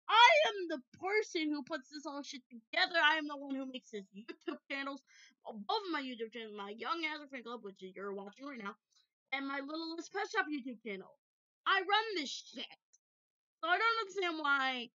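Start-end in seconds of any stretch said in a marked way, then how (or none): 0.1-4.6 louder, higher indignant rant. Real, not acted. 11.7-14.9 high, loud exasperated claim; indignant. Real, not acted. Indignant rant at the pet.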